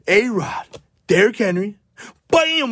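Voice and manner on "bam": excited. Real, not acted. Card reveal.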